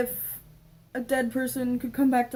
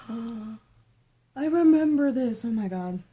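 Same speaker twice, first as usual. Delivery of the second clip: sudden gasp, slow and louder; gasping recognition; 5 dB louder, much slower than usual. Real, not acted. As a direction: gasp as the memory hits. Gasp at memory of diary.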